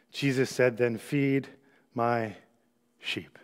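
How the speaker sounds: low, soft, measured reading; solemn scripture reading. A narrator's reading. Preacher reading Jesus questioning Peter to the congregation, solemn scripture reading. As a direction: read it gently, with weighted pauses. Reads the Scripture passage aloud.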